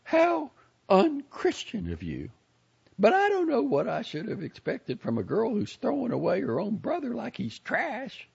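high, scolding church lady; indignant old lady. A narrator's reading. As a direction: scold her, self-righteous. Lady character voice.

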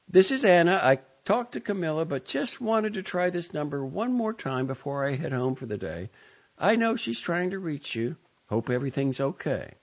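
high, polite coordinator voice; bright professional voicemail. A narrator's reading. Third voicemail.

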